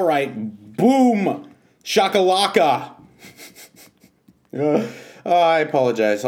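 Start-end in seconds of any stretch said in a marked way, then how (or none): 0.8-2.9 hype shout. Acted. Hype exclamation after ad.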